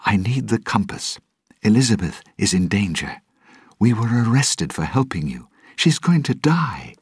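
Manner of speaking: high-pitched, desperate appeal; urgent plea. Acted. Will begs Jack to help Elizabeth in danger, urgent plea. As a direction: plead urgently, pitch climbing high by the end.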